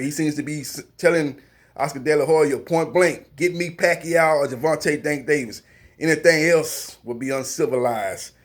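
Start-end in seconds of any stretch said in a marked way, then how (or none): none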